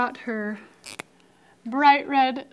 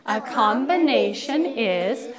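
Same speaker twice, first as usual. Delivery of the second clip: slow, measured "combination is"; chant-like, spaced-out "combination is"; group recitation; slower than usual. Shown as a demonstration. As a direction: lead the class through "combination is" slowly.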